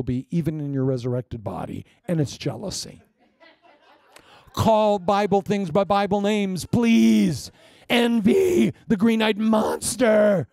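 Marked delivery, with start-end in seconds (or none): 4.5-10.5 loud, high, slow preaching; preaching shout. Real, not acted. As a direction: hammer home the point. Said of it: "shouted preaching".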